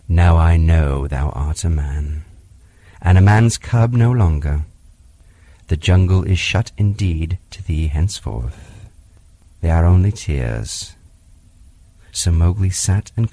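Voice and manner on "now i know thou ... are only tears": tender panther. Acted. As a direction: comfort the crying boy. Bagheera comforts Mowgli.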